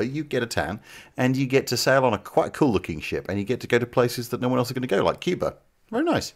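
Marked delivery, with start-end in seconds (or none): 5.9-6.3 high sarcastic praise. Pretend. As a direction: say it with a wry lift.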